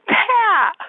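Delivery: put-on high child's call to the bird; little girl calling her bird friend. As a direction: call the name up high like a toddler.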